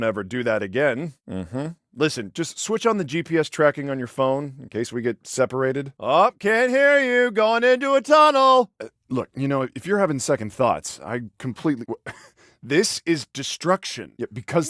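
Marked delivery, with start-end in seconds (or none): 6.0-8.7 loud, high, drawn-out calling; feigned bad connection. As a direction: pretend to shout over a failing line. Pretends the phone is cutting out.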